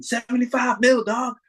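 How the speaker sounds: high mock yell at a mate; teasing yell from the doorway; shouting. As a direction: shout it up high across the room. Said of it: "[name] starts shouting".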